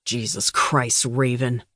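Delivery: low and harsh, slowed down. Acted. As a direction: growl her name in frustration. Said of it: "Glenn said in a low, harsh tone of voice".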